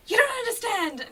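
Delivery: high, loud, frantic burst; overexcited. Retold or imitated. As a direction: blurt it out breathless with joy. Telling her friend the tour news.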